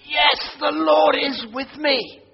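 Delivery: high, slow, loud, delighted affirmation; joyful certainty. Retold or imitated. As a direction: say it like a happy discovery. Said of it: "a bit of liturgy that makes me think".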